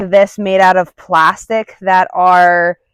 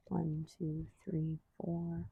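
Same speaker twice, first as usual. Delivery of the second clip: much softer, barely voiced counting; under-breath count; 3 semitones lower, 25 dB quieter, slower than usual. Real, not acted. Trainer counting duration under her breath. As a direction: count quietly to yourself.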